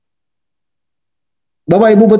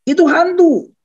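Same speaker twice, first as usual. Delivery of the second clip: startled cry; 12 semitones higher, slower than usual. Acted. Startled disciples mistake Jesus for a ghost, crying out. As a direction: cry out in mock alarm, pitch falling off. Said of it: "terkejut dan berseru".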